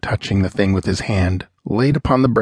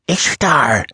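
a sharp, higher gasped exclamation; gasped cry; 6 semitones higher than usual. Acted. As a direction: cry out the god's name in awe. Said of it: "he gasped".

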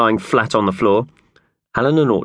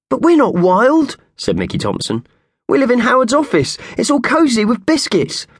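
pleading protest; 3 dB louder than usual. A narrator's reading. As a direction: protest innocently. Mickey protests, high and pleading.

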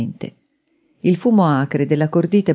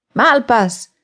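higher, stentorian shout; shouted call; 7 semitones higher, 4 dB louder than usual. A narrator's reading. Man calling his hidden boss from downstairs, stentorian shout. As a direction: call the name out to ring up a stairwell. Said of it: "gridò ancora una volta".

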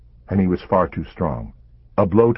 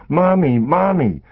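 high, loud terrified cries; child screaming; 11 semitones higher, 6 dB louder, slower than usual. A narrator's reading. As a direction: scream for mommy in panic. Daughter screaming during attack.

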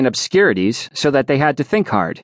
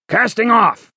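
much higher shouted reply; called back; 10 semitones higher than usual. Acted. First mate shouting back to Sinbad, much higher. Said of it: "the first mate confirmed".

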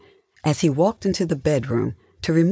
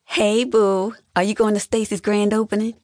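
high, quick, perky phone voice; bubbly sister on the phone; chatty younger sister; 9 semitones higher than usual. A narrator's reading. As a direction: breeze in with a familiar greeting.

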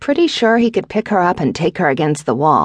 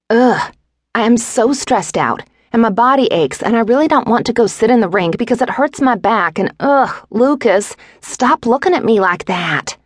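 higher-pitched, whiny exasperation; exasperated venting; 7 semitones higher than usual. A narrator's reading. Stressed pregnant wife to husband, exasperated venting. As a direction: pour out complaints, fed up.

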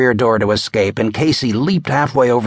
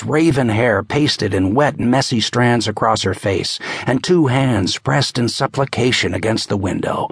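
plain reading voice

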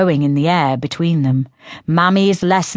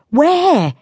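put-on higher, light teasing girl's voice; girl Synth voice; 11 semitones higher, 3 dB louder than usual. A narrator's reading. Sister taunting Diello about his tale, in a higher teasing voice. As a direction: taunt him as the younger sister.

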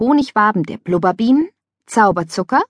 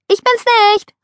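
very high, louder playground shout; playful call; 5 dB louder than usual. A narrator's reading. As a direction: shout it while swimming away. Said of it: "rief Mia".

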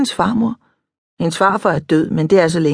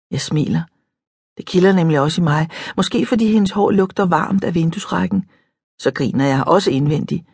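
ordinary delivery

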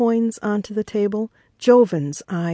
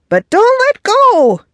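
very high, loud yelp; excited yelp; 8 dB louder than usual. A narrator's reading. Old-timer to rookie fighting the shark, high yelp. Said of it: "yelped the old-timer".

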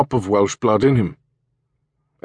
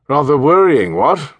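throaty posh English major, raised and loud; upper-class English; 7 semitones higher, 7 dB louder than usual. Acted. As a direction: play a pompous retired English officer. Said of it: "in a throaty, upper-class English voice".

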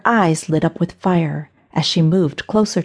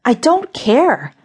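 slow, defiant retort; shot back; 5 semitones higher, slower than usual. A narrator's reading. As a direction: fling it back at her. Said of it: "Linda shot back".

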